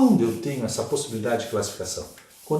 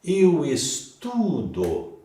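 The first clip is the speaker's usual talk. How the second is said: "estudo" drawn out very slowly with TU stressed and higher; 5 semitones higher, much slower than usual. Shown as a demonstration. Teacher showing the stressed root syllable, stress on TU in "estudo". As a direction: stretch the middle syllable TU in "estudo". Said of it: "a sílaba tônica está no radical".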